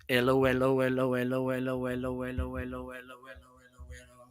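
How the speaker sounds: monotone hellos fading away to almost nothing; steady patter receding into the distance; moving around microphone. Shown as a demonstration. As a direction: repeat evenly while circling behind the mic. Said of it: "move it around so you can tell the difference".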